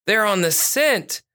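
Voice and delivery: a touch of excitement, voice lifted; officer's excited remark. A narrator's reading. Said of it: "Officer Lauren said".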